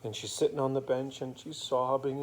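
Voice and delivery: plain reading voice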